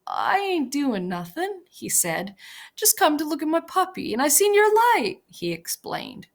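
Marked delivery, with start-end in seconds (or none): 0.1-1.6 higher, timid simple man; Lenny's timid voice. A narrator's reading. Lenny's voice, childlike. 2.8-5.2 higher, hurried, innocent plea; Lenny pleading. A narrator's reading. Lenny's voice, childlike.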